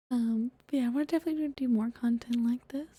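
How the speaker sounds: ordinary delivery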